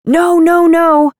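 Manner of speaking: loud, high panicked no; scream. Retold or imitated. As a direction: scream it in alarm from the background. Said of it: "the child's parents scream".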